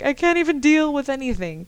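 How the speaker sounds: loud, high mock exasperation; overwhelmed outburst. Podcaster mimicking her sleep-deprived self, overwhelmed outburst. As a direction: throw it out as an exaggerated can't-cope complaint. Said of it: "No wonder I was like".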